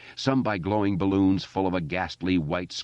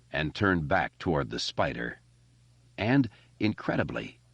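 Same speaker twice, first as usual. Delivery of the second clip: ordinary delivery, nothing marked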